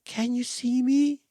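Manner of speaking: high and soft from far off; far away. Acted. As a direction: call from the distance.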